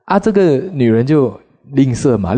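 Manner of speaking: usual speaking voice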